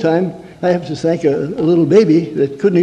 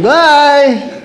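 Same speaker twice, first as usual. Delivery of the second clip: high, bright put-on farewell; fake cheerful bye; 9 semitones higher, 5 dB louder than usual. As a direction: chirp a sweet goodbye. Fake cheerful goodbye wave.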